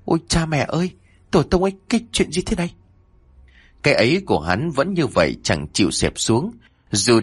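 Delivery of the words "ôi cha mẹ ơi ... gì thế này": the thé, vội vã, giọng cao hẳn lên; kêu lên như người sợ mất vía; run rẩy hoảng hốt. A narrator's reading. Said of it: "Hắn run rẩy nói một mình". (giọng cao hoảng hốt, nói nhanh)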